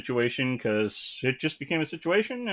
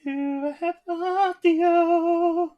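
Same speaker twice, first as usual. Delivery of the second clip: squeaky drawn-out mic check, very high; high slow mic test; 6 dB louder, much slower than usual. Pretend. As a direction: stretch it out way up high like a silly test voice.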